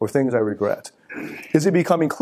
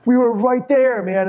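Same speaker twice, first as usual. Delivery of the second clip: coach quote; 10 semitones higher, slower than usual. Retold or imitated. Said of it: "my method acting coach ... Like".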